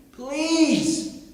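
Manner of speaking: high, drawn-out please; pleading Moses. Retold or imitated. Reluctant Moses implores God to excuse him, pleading. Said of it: "Moses says".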